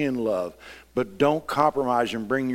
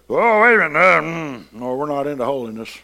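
mock upset churchgoer; 4 semitones higher, 8 dB louder than usual. Mocks upset church member.

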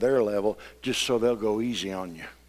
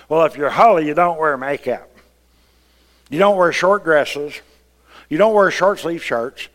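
mock legalist; 5 semitones higher, 10 dB louder than usual. Mocks legalistic rules.

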